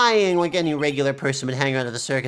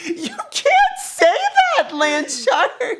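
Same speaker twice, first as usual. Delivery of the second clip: high, slow, cracking up; laughing protest; 4 dB louder, much slower than usual. Real, not acted. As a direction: protest while laughing hard. Scolding through laughter.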